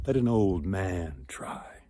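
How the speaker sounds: soft, slow whispered request; old beggar speaking almost inaudibly; barely heard whisper. A narrator's reading. Beggar asks the suitors quietly, in a whisper. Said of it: "They barely heard him at first".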